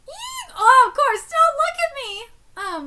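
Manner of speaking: very high squeal then loud, slow cry; embarrassed shriek. Acted. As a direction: scream in shock and shame. Princess shrieks being told undress.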